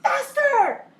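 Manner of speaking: a swimmer screaming in triumph at the finish line; triumphant scream. Retold or imitated. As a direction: yell it out as you hit the wall. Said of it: "and I screamed".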